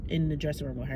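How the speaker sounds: ordinary talk, nothing marked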